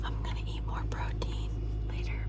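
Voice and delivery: soft whispered aside; whispering. Real, not acted. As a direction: whisper it to the camera.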